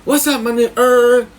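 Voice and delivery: put-on high, stretched, accented greeting. Cellmate greeting the neighbor daily, high oblivious voice. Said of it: "V would say the same shit to him".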